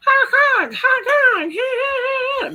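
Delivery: mock peacock cry, very high and loud; high squawking peacock honks. Imitating the peacock outside her car at night, high squawking honks.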